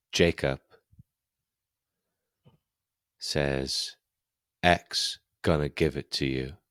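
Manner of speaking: quiet, suspenseful game master voice; game master scene setting. A narrator's reading. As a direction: narrate softly, leaving long silences. Game master sets grim scene.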